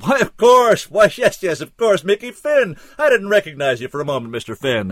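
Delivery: high, loud, hearty recognition, easing off; bellowing. A narrator's reading. Barkeep recognizing Mickey Finn with a wide grin, bellowing. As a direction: bellow a delighted welcome. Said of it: "he bellowed".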